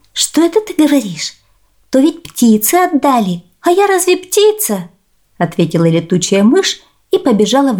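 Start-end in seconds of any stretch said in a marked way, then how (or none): 0.1-4.9 высокий голосок хитрой мыши; тонко, с притворным удивлением; голос летучей мыши. Acted. Мышь отвечает гусям. (высокий удивлённый голос мыши)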